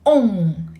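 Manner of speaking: ong with lips shaped round and pushed out. Shown as a demonstration. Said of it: "shape your lips into this".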